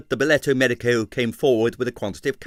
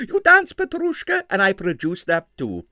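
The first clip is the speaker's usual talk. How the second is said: insistent impresario; 9 semitones higher, 4 dB louder than usual. Acted. Stroganov insisting.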